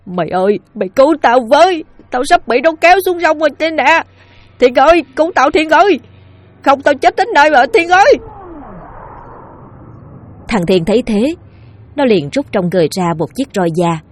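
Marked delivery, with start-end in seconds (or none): 0.0-8.1 high, loud panicked plea near tears; a man being pulled into the river, screaming for help; crying out for help. Acted. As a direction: beg for your life as you're dragged down. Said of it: "nói như khóc".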